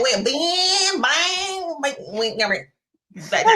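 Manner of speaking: playful Southern twang on bing, bang, bang, pitched high. Pretend. Host joking that her Southern slips out, Southern twang. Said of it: "that Southern come out on me".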